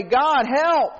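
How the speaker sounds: high acted plea for help; imitated prayer. Israelites in trouble appeal to God, imitated high plea. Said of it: "they would pray".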